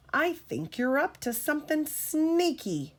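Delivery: high, pointed suspicion; a lightly voiced suspicious engine; suspicious puff. A narrator's reading. Spencer accusing Thomas, lightly suspicious. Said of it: "he puffed".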